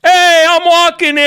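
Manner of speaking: loud, high New Jersey street shout; New Jersey yell. Retold or imitated. New Jersey guy shouting at traffic, loud New Jersey accent. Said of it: "walking around in New Jersey".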